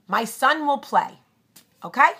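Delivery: mother offers her son in a loud Brooklyn voice; brash Brooklyn accent. Retold or imitated. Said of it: "had a very heavy Long Island accent, Brooklyn".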